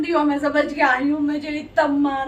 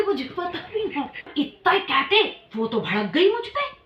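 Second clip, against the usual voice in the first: ordinary talk, nothing marked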